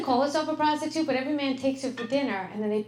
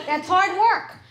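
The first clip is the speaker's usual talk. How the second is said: higher, louder, slowed proud boast; mock-proud wife; 7 semitones higher, 6 dB louder, slower than usual. Wife insisting to husband that folding is hard work, mock-proud. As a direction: brag like folding a sheet is heavy labour.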